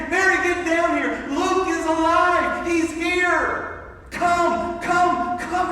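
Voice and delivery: loud, high excited shout; yelling up to Mary. Retold or imitated. Husband yelling upstairs to wife, loud and overjoyed. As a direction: call her down the stairs at full voice. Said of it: "I yell up to Mary".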